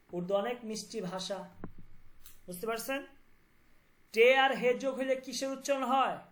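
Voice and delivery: ordinary talk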